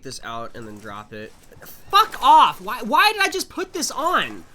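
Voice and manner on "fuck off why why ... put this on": high, loud panicked shriek; startled scream. Real, not acted. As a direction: scream in sudden fright. Startled by monster.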